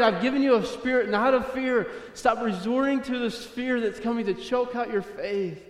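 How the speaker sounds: high, driving exhortation; impassioned preaching. Acted. Preaching God's charge to David.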